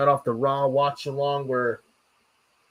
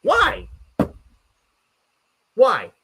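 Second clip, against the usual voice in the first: exasperated why; 6 semitones higher, 4 dB louder than usual. Real, not acted. Outraged rant about booking.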